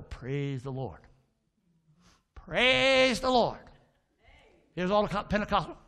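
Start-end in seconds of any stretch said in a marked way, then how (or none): 0.0-1.0 low, softer praise; feed the bird its line, low and soft; low prompt to bird. Acted. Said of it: "and I'll go". 2.3-3.6 loud, high, drawn-out bird squawk; talking bird. Acted. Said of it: "he's a talking bird".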